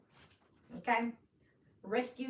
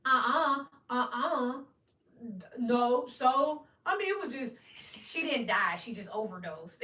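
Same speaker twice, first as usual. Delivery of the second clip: 1 mock brother denying; 2 semitones higher, 5 dB louder, slower than usual. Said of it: "my brother's on his third attorney where he's like".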